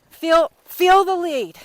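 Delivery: loud, slow, drawn-out coaching call; a loud, slow coach's yell. Real, not acted. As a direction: call it out across the arena to the riders. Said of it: "I'm sorry I'm yelling at you kids".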